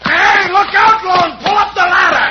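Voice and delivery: high, loud urgent warning; shouted warning. Acted. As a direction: yell a warning up to the hayloft. Warning partner during fight.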